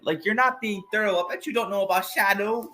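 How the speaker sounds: lightly voiced high, sassy reporter; Amy Rose. Reporter chiding two detectives for rushing, lightly voiced sass.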